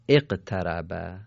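اقتربه begun with "e": eqtaraba; kasra on alef. Shown as a demonstration. Teacher answering by the rule, اقتربه said "eqtaraba". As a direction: say اقتربه deliberately, opening on "e".

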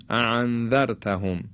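اَأَنذَرْتَهُمْ with ayn in place of hamza. Shown as a demonstration. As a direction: squeeze the throat on the start of اَأَنذَرْتَهُمْ like ع. (اَأَنذَرْتَهُمْ با عین به جای همزه)

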